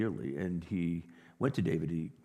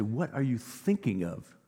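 caring friend; 9 semitones higher than usual. Retold or imitated. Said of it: "your friends will say".

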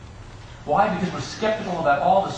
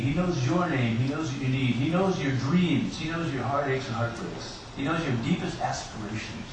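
normal talking voice

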